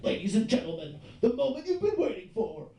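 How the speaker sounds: booming announcer intro, stretched out and building; arena announcer building to a stretched-out big finish. Retold or imitated. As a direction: hype the crowd like an arena announcer. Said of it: "An announcer gets on and he's like".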